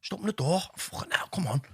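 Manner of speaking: lightly hammed-up exasperated husband; mock exasperation. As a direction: play the put-upon husband, high then hissed. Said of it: "like I'd done nothing wrong".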